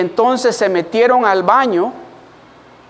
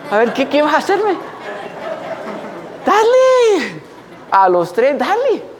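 cocky taunt, much higher, laughing; taunting dare; 6 semitones higher than usual. Retold or imitated. Boy taunting bullies behind grandfather, high and laughing. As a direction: goad them with a laugh. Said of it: "Y yo le digo".

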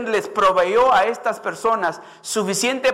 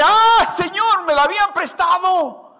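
anguished cry; 10 semitones higher, 4 dB louder than usual. Acted. Woodcutter crying out over the borrowed axe, anguished. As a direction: let the loss ache in the voice. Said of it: "Por eso dice".

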